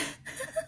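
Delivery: soft, very high-pitched laugh; small self-amused laugh; high soft giggle. Real, not acted. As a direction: giggle softly at yourself. Laughs about drooling.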